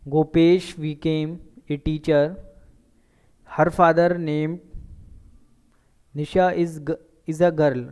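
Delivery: very slow, word by word for the class; slow dictation. Shown as a demonstration. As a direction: spell it out slowly so students can write. Teacher dictates example sentences. (धीरे-धीरे बोलकर लिखवाना)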